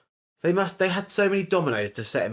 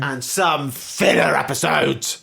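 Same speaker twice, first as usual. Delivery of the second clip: loud exclamation; 6 dB louder, slower than usual. Real, not acted. Mocking American TV.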